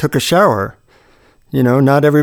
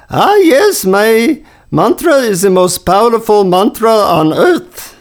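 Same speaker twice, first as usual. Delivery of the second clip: high, put-on Indian accent, sing-song; imitating the Indian guru's accent, pitched high; 9 semitones higher than usual. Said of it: "in his deep Indian accent".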